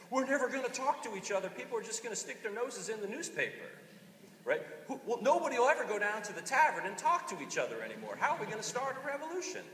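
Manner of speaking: high, hammed-up Southern worrier; mock Southern alarm over newspapers; Southern accent. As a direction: fret like an old Southern townsman. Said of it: "I always throw the accent in there".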